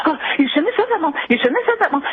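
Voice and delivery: high-pitched frantic pleading, retold without extra volume; retold freak-out. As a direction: act out a terrified daughter's alarm. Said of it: "she just freaked out".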